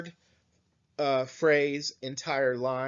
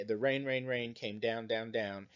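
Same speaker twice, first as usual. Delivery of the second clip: soft rain refrain; 8 dB quieter than usual. Shown as a demonstration. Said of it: "we say".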